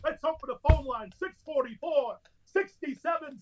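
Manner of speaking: crank the on-air voice all the way up; over-the-top radio host. Retold or imitated. Host mocking his rival by shouting his own show, laughing. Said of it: "like, like".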